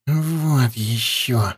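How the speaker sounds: slow, irritated hiss. A narrator's reading. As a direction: hiss it out in annoyance. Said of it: "прошипел Аршалай с раздражением".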